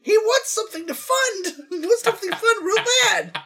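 high, loud mushroom squeak; high squeaky Toad voice. Acted. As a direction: squeak it like a tiny mushroom man. Toad voice riffing.

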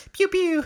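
very high little gun noise; lazy-finger gun pew. Retold or imitated. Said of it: "a lazy finger".

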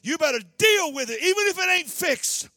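full-force preaching. Acted. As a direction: whoop and build the sermon.

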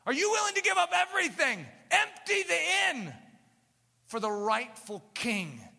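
high, loud, urgent challenge; rising preacher call. Real, not acted. Preacher challenging congregation, loud and rising. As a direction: throw it at them from the pulpit.

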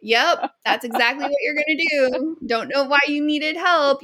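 ordinary talk

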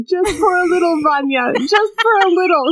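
lightly mocked whimper; imitating her. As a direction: plead it like a hurt heroine. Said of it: "He does a little impression of her".

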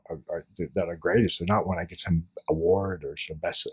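usual speaking voice

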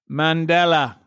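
MANDELA in one smooth arc; melodic. Shown as a demonstration. Host proving the name sounds better, melodic. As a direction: let MANDELA flow across three syllables. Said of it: "I mean, melodically".

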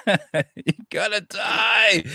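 slow, high laughing cry of doom. Real, not acted. Laughing at Titanic scenario.

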